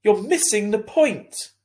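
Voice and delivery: high, loud, slow angry mother; yelling. A narrator's reading. Mother yelling at son in principal's office, loud and slow. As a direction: yell it slowly, exasperated. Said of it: "Mum yelled".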